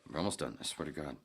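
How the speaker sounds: ordinary talk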